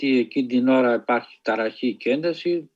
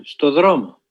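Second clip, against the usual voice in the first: a short, louder barked order; soldier's shout; 5 dB louder than usual. Retold or imitated. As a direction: call out the order sharply. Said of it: "αμέσως φωνάζει".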